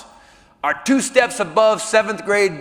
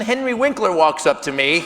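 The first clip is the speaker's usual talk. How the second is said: normal talking voice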